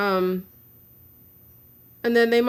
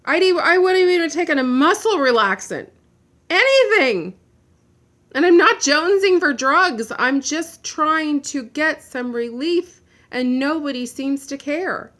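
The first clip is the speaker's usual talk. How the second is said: louder and higher, real frustration boiling over; exasperated outburst; 4 semitones higher, 4 dB louder than usual. Real, not acted. To her viewers, pleading that she only wants relief, raw exasperation.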